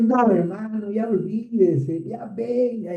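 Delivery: high, lightly amused brush-off. Retold or imitated. Pastor telling the brother to forget it, high and amused. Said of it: "Y dije".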